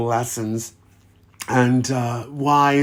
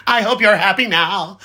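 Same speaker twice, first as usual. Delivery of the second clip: laughing mock scold; 7 semitones higher than usual. Laughing as he playfully blames the singing princes. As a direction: tease them with a giggling fake reproach. Teasing the princes who caused agony.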